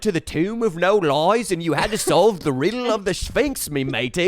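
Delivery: high, exaggerated copy of his British accent; mock British accent turning piratical. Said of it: "I thought he said the tomb with no lies".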